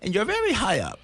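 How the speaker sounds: high, prim caseworker voice; mock caseworker. Dad imitating the home study lady, high and prim. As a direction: point it out like a fussy inspector. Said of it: "she's like".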